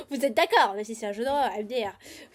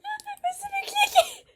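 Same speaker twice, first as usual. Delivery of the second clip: laughing shriek; slower than usual. Real, not acted. Game glitch shock.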